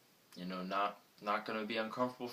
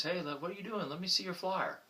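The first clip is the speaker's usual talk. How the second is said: much higher, casual call from a window; diner calling out; 11 semitones higher than usual. Retold or imitated.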